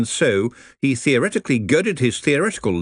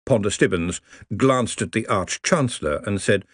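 ordinary delivery, nothing marked

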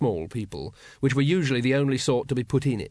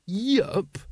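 high-pitched questioning repeat; incredulous echo; 8 semitones higher than usual. A narrator's reading. Grand Master repeats a brother's casual answer, incredulous. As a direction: echo the word as if it cannot be right.

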